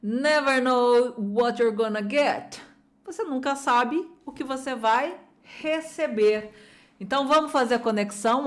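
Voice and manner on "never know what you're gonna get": slow, careful model of the clip line; slow repeat. Shown as a demonstration. Teacher modelling the film line slowly for students. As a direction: say it slowly for learners to copy. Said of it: "Só ouvidos atentos".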